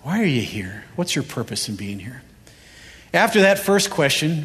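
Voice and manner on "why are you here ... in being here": ordinary delivery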